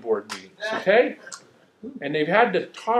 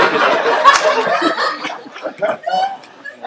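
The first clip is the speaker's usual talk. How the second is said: much higher, loud laughing fit; big laugh; 7 dB louder than usual. Real, not acted. Preacher cracking up at his own story, loud and high. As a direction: break up laughing.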